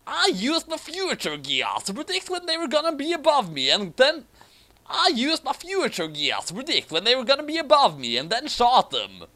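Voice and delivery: high, smug explanation; boastful villain. Acted. Ron Paul bragging to Schneisel, high smug villain. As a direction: brag about the clever trick.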